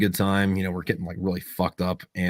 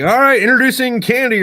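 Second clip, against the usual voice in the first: imitating a DJ announcing, much higher and louder; strip club DJ; 13 semitones higher, 8 dB louder than usual. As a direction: hype up the dancer like a club MC.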